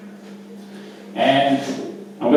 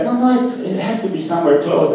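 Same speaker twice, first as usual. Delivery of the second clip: insistent stranger; 7 semitones higher than usual. Retold or imitated.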